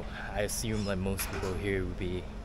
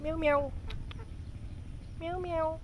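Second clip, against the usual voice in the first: very high squeaky meows at a cat; slower than usual. Real, not acted. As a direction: coo at the kitty in a tiny voice. Calling a street cat.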